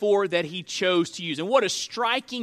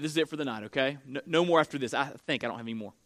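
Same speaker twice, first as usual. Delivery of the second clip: dropped, much softer side remark; quiet aside; 6 semitones lower, 6 dB quieter, much faster than usual. Real, not acted. Pastor softly telling someone there's no more quotes, quiet aside. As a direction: murmur it off to the side, not for the crowd.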